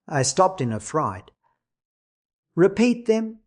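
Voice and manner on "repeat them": high, heartbroken plea. A narrator's reading. Bereaved woman asks for his last words, heartbroken. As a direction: plead in a heartbroken, raised pitch. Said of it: "she murmured in a heartbroken tone".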